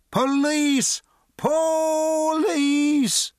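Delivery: very high, loud long call; drunken shout. A narrator's reading. Drunk suddenly yells for the police, loud drawn-out shout. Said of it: "abruptly began to shout".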